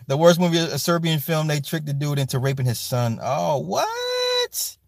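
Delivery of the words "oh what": high disbelieving oh what; shocked squeal. Real, not acted. Reacting to the Serbian film plot with a high shocked squeal.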